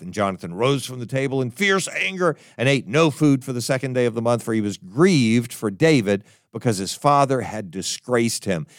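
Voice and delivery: ordinary talk, nothing marked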